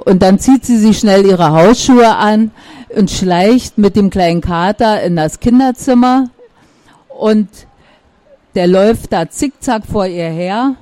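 ordinary delivery

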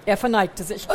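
ordinary delivery, nothing marked

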